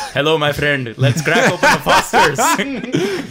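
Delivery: high, slow put-on Indian accent; mock Indian accent. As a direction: badly fake an Indian accent for a laugh. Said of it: "[name]'s gonna do an Indian accent".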